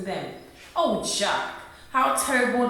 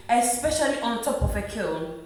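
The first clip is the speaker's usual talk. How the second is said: normal talking voice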